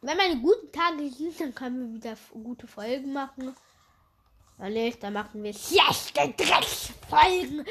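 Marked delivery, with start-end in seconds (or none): none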